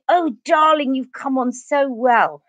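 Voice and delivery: gushing posh teacher, starting high; high swoop into slow, mock-grand approval; Yvonne Darling. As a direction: imitate an elocution teacher's lavish praise. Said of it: "And she".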